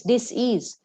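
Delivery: "dis-ease" slowly split into dis and ease; slow split word. Shown as a demonstration. Teacher explaining disease to the audience, slow and split. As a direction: stretch "dis-ease" apart, dis, then ease. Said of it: "it became dis-ease".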